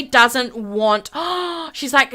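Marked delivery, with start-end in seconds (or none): 1.2-1.7 quick theatrical intake; shocked gasp. Acted. Whitney recoiling from Stephanie, shocked gasp. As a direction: gasp in mock horror.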